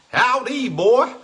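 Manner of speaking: very high, loud cowboy howdy; cowboy accent. Acted. Host jokes with Bob in a loud, high cowboy accent. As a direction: call out in an exaggerated cowboy accent. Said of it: "my cowboy accent is really good".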